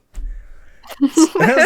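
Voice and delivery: loud burst of laughter; big laugh. Real, not acted. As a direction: crack up loudly at own pun. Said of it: "Sorry, sorry".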